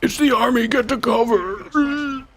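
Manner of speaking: high, strained mimicry of a game soldier; mock soldier. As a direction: parody an urgent warning, dragging out the last words. Mimics the game's soldier line.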